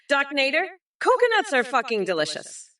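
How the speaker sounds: usual speaking voice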